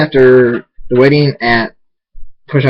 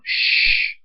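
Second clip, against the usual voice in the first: long hushing hiss; drawn-out unvoiced shush; 7 dB quieter than usual. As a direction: shush a noisy kid. Hushing noisy Sweetbell at wedding.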